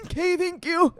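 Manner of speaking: small, high, choked-up thanks; holding back tears. Retold or imitated. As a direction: force a polite thanks through tears. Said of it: "I was like".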